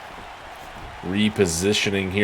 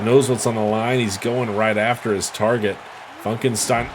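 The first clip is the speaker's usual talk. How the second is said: ordinary delivery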